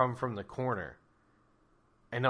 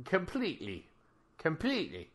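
nervous stammer; 7 semitones higher, 4 dB louder than usual. Acted. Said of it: "Ricky is like".

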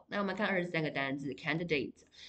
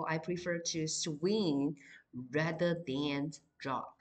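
slow, careful example sentence; slow dictation of the example; slow example read; slower than usual. Shown as a demonstration. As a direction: stretch it out for the class to write. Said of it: "所以就变成，for example".

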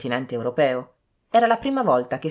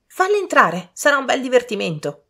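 high, loud eager youngsters; excited cries; 9 semitones higher, 5 dB louder than usual. A narrator's reading. Young people clamouring for amusement, excited high cry. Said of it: "gridarono tutti insieme".

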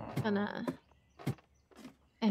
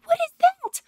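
much higher, loud panicked cry; startled shriek; 12 semitones higher, 10 dB louder than usual. Real, not acted. Player startled by something appearing, high loud shriek. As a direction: shriek at the sudden threat. Sudden scary thing appears.

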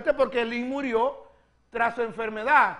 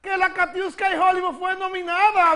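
usual speaking voice

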